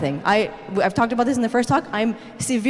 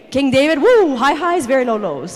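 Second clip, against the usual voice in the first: highs and lows; 2 semitones higher, 5 dB louder than usual. Shown as a demonstration. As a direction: voice rides up, then sinks.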